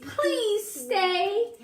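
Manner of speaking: high pleading little voice; begging. A narrator's reading. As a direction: beg them sweetly to stay. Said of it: "Miss Spider begged".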